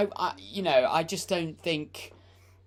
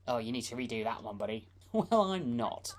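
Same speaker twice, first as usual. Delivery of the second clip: usual speaking voice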